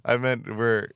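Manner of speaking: loud, high, laughing alarm at own words; flustered backpedal. Real, not acted. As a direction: scramble to take it back. Realizes awkward phrasing.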